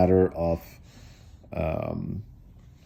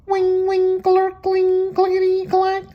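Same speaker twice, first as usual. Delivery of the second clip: very high mechanical wing cling clickety clack; machine noises; 3 dB louder, slower than usual. A narrator's reading. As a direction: sing out the computer's whirring. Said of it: "The computer was going".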